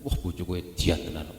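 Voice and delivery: low, soft mock awe; admiring exclamation. Husband praising his spouse, in hushed mock amazement. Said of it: "Sak bojo-bojone diedanke tenan".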